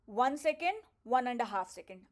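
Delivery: ordinary delivery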